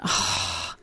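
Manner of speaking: frustrated sigh. Retold or imitated. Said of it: "discouraged and frustrated".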